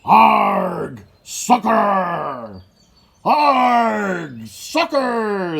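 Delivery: loud high pirate yell; crew yelling. A narrator's reading. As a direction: yell it while chasing the ball. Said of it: "the crew yelled".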